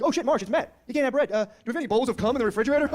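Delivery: high, loud teasing relatives; mocking uncles. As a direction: ham up the uncles ribbing him.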